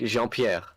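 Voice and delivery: "Jean-Pierre" as "zhon-pyair", French nasal vowel; French pronunciation. Shown as a demonstration. Giving an example of French names, "Jean-Pierre" said as in French. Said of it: "I really like French names".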